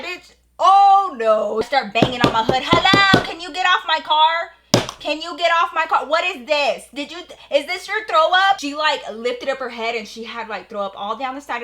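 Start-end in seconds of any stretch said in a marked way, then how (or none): 0.0-1.6 loud, high, drawn-out outrage; outraged dismay. Retold or imitated. Storyteller on spotting who is on the car, loudly outraged. Acted shock on seeing who it was. 2.6-8.6 loud, high, indignant calling out; angry confrontation. Retold or imitated.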